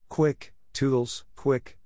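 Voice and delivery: high, slow fairy voice urging; a sly fairy egging him on; mild fairy scream. A narrator's reading. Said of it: "she screamed".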